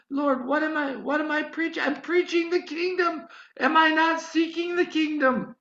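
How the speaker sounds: high, loud exasperated protest; indignant protest. Retold or imitated. Preacher arguing with the Lord, high and exasperated. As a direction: argue back, pitch climbing.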